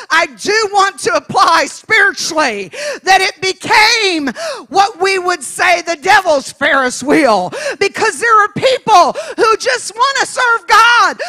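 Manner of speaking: high, loud, driving sermon shout; full-force preaching. Real, not acted. Preacher applies the ride to betrayed believers, full-force preaching. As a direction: drive each point home at full voice.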